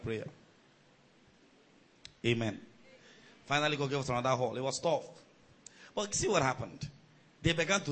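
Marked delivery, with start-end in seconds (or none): none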